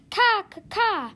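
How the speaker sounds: high, loud cawing alarm; loud crow caws. Acted.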